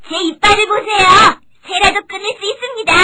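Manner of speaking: high, loud little mouse; confident mouse. Acted. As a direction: proudly show off in a high, loud voice. Mouse boasts of teeth. (높고 큰 목소리로 자신만만한 생쥐)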